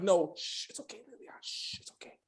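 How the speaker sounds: softly whispered comfort, mock tender. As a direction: hush her like a dying friend, in a whisper. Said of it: "He's like".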